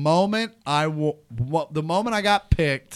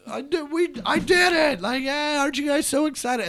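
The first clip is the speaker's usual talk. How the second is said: overexcited boasting; 14 semitones higher than usual. Retold or imitated. Winner bursting in on the losers, gleefully shouting. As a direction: crow about winning to the room. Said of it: "I came in with this".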